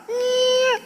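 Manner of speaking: a high squeaky gate creak. Retold or imitated. The gate creaks as the husband comes home, high squeak. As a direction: squeak it out like a rusty gate opening. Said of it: "o portão faz".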